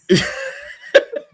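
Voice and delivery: breathy startled laugh; high burst of laughter. Real, not acted.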